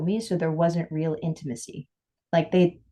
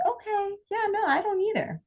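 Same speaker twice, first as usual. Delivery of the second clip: patient partner. Retold or imitated. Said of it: "people have the patience to be like".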